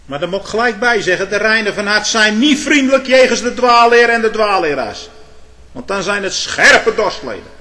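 loud, high, forceful pulpit warning; fel prekend. Real, not acted. Preacher warns against false teachers. (luid en fel waarschuwend)

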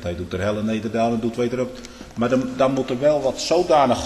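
usual speaking voice

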